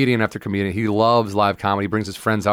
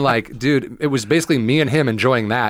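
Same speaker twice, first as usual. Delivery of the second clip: usual speaking voice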